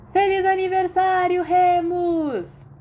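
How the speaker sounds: loud, high birthday cheer; party shout. A narrator's reading. Whole common room cheers Remus as he walks in, loud shout. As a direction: burst out with a surprise yell. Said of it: "A sala comunal inteira explodiu".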